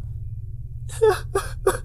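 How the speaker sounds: high broken sob on the breath; crying gasp; choked sob. Acted. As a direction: let a wet sob catch in the throat. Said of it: "continuou a soluçar".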